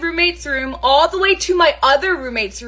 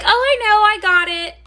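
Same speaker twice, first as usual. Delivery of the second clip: breezy brush-off; 9 semitones higher, 6 dB louder than usual. Retold or imitated. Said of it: "the roommate would be like".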